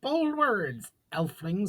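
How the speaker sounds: put-on elderly goblin scoffing at the elf. A narrator's reading. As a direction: sneer down at the upstart. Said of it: "scoffed an elderly goblin".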